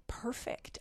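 breathy whisper of delight. Real, not acted. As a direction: whisper it in awe of the pie. Savoring pie while praising.

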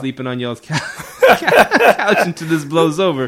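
laughing loud playful voice; half-laughing, booming plea; loud excited call. Retold or imitated. Retold call to dad, loud laughing.

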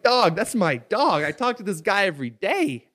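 loud, high-pitched disbelieving protest; indignant outburst. Retold or imitated. Telling friend about the copycat, indignant high outburst. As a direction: burst out loudly, re-enacting your outrage. Retelling his outraged reaction to friend.

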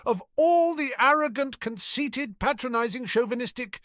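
very high-pitched sputtering outrage; indignant girlish tirade; furious. A narrator's reading. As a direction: spit out the insults in a high, furious voice. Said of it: "furious Ginny Weasley".

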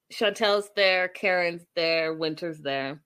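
ordinary delivery

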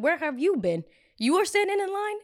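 high, loud incredulous questioning; retold indignant challenge. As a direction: challenge the man, acting out disbelief.

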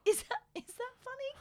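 high, disbelieving question; high incredulous. Retold or imitated. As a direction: act stunned by the remark. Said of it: "And I was like".

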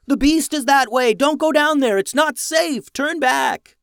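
high, loud panicked warning; yelling. Acted. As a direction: shout a warning to turn back. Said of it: "yelling at them to go back".

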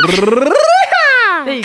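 high drawn-out hype call; hype announcer. Acted. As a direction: stretch it like a big announcement. Drum roll announcement hype.

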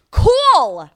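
high, loud falling exclamation; sarcastic shout. Real, not acted. Podcaster answering her correctors, sarcastic shout. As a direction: throw it out loudly in scorn.

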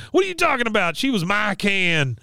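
high-pitched indignant impression; Dale. Arguing with Bill over the can, indignant impression. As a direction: protest in a put-on high voice, drawing out the end. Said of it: "And Dale says".

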